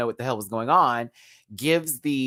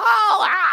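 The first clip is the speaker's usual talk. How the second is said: long high loud scream; celebratory scream; 8 dB louder than usual. Real, not acted. Host cheering Jada's win, joyful scream. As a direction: shriek for the winner.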